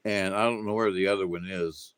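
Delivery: normal talking voice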